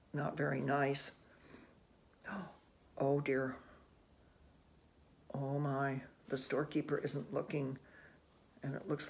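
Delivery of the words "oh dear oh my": plain reading voice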